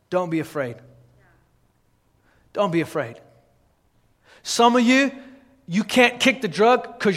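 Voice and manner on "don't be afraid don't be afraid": soft, low reassurance; quietly reassuring, falling away; gentle reassurance. Real, not acted. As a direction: drop low and quiet to comfort the listener.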